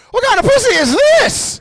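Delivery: high-pitched incredulous call at full voice; echoing call. Acted. Comic questions what he is facing, high echoing call. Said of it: "First pussy echo".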